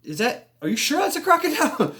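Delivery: high-pitched incredulous question, breaking into laughter; amused disbelief. Retold or imitated. Asking if that's really a crocodile, high incredulous disbelief. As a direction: squeak it out, unable to believe the size.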